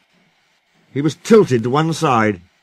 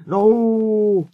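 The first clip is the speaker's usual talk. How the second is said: high, drawn-out plea; long, high, distressed protest; pleading; 9 semitones higher than usual. Acted. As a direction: wail it imploringly. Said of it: "pleaded Skarloey".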